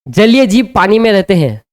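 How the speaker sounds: ordinary talk, nothing marked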